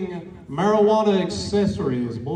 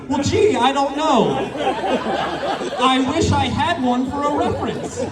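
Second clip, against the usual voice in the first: sarcastic; 7 semitones higher than usual. Retold or imitated.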